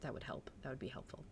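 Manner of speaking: soft unvoiced whisper; hushed side comment; whispered aside. Real, not acted. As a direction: whisper it to yourself. Muttering aside to mod.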